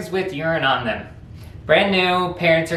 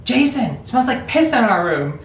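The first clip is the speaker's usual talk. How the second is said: put-on raised, disgusted older brother voice, pitched up; mock older brother; 7 semitones higher than usual. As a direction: play the grossed-out big brother. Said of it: "he goes".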